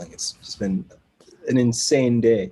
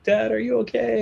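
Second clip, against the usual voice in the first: much higher, worried daughter voice; imitated crying daughter; 11 semitones higher than usual. Daughter checking on collapsed dad, imitated distress. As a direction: lightly mimic a distressed daughter, without sobs. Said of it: "like, crying".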